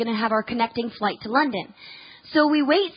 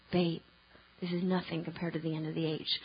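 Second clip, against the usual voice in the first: husband's calm voice; 8 semitones lower, 13 dB quieter than usual. Retold or imitated. Husband's words remembered again.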